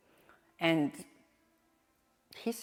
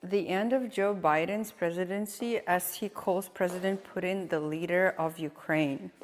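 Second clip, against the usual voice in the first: ordinary talk, nothing marked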